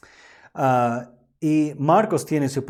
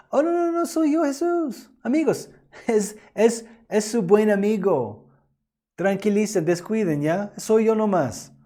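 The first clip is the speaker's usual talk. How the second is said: much higher, breezy buddy voice; mock casual Jesus; 8 semitones higher than usual. Preacher mocking the wrong idea of Jesus, high and chummy. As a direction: play a chummy, offhand pal brushing it off. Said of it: "No deberíamos pensar que Jesús se está acercando al barco para decir".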